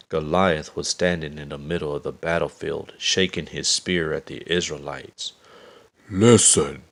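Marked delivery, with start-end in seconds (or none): 6.1-6.8 loud booming giant roar. A narrator's reading. Giant Goliath roaring at the Israelites, loud. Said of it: "he roared".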